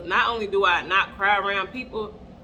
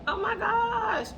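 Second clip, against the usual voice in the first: much higher, slow, drawn-out dismay; dramatic oh my gosh; 13 semitones higher, slower than usual. Retold or imitated. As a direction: stretch it out in mock despair. Reacting to being strung out.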